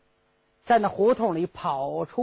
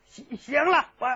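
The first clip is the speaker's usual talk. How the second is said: usual speaking voice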